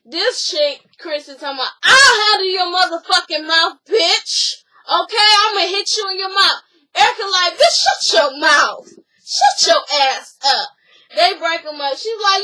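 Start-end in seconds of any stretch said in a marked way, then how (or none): 1.8-6.6 loud, high yelling; imitated shouted threat. Acted quarrel, threat. 7.6-10.7 loud, slow and forceful; imitated slow shout. Acted quarrel, shout back.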